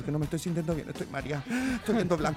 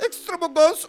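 [name] impression; 14 semitones higher, 11 dB louder than usual. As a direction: belt it out like a pop diva. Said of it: "¿Cómo diría [name] extravaganza".

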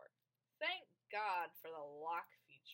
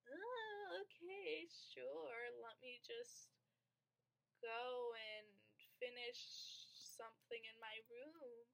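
high, soft stalling voice; feigned compliance; 5 semitones higher, 4 dB quieter, slower than usual. Retold or imitated. Kid putting off mom's movie call, feigned compliance. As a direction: imitate a kid innocently agreeing, easing down in pitch.

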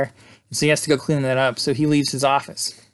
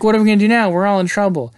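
panicked; 6 semitones higher, 5 dB louder, faster than usual. Retold or imitated. Kids trapped at dead end.

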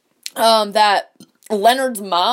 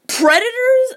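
high-pitched questioning disbelief; retold astonished one-word question; incredulous echo; 13 semitones higher than usual. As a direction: throw the word back, high and appalled.

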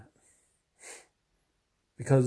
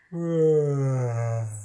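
fake yawn; 3 semitones higher, 4 dB louder than usual. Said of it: "I'm fake yawning".

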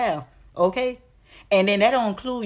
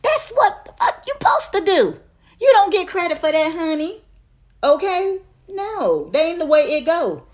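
much higher and louder, outraged; shrill indignant yell; outraged shout; 10 semitones higher, 5 dB louder than usual. Real, not acted. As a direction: shriek it at him in disbelief. Scolding men about kids.

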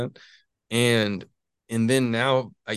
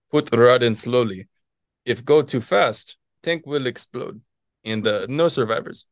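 deliberate warning in thick imitated Russian; strong Russian accent; 5 dB louder than usual. Retelling a colleague's liquid helium warning, strong Russian accent. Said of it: "in his really strong Russian accent".

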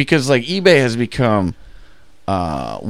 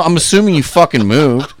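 louder, higher, fed-up snap; retelling a sarcastic reply to a clueless eBay buyer; exasperated retort; 7 semitones higher, 5 dB louder than usual. Retold or imitated. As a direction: let the frustration spill out.

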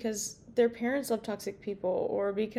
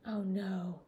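quiet oh no; 2 semitones lower, 6 dB quieter than usual. Real, not acted. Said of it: "I already labeled this as explicit".

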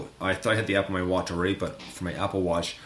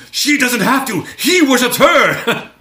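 very high, loud, slow, through laughter; laughing punchline; 13 dB louder, slower than usual. Retold or imitated. Fan quoting a Frasier line, laughing hard. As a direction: squeal the line while cracking up. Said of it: "she's like".

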